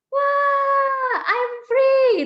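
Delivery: high, loud, drawn-out cry of freedom; jubilant freed grasshopper. Acted. Grasshopper released from its box, jubilant high shout. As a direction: squeal it out as a tiny creature let loose. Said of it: "sekarang saya jadi belalangnya gitu".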